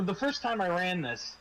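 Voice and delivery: usual speaking voice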